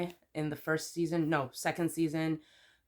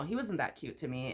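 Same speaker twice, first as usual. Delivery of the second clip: ordinary talk, nothing marked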